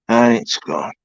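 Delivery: usual speaking voice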